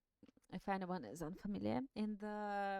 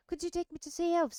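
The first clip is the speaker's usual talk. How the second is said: eager plea; 8 semitones higher, 6 dB louder, faster than usual. Acted. Sam begging to see elves.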